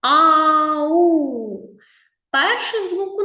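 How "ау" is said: high, loud drawn-out Ау; girl calling out. Acted. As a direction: call Ау like a lost girl. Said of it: "Вона кричить". (протяжне гучне «Ау», як дівчинка кличе)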